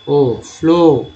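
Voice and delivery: फ्लो with a long, slow ओ; vowel demo. Shown as a demonstration. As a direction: draw out ओ in फ्लो slowly. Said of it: "double ओ क्या पढ़ते हैं?". (फ्लो में ओ को लंबा बोलना)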